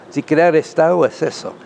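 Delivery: ordinary talk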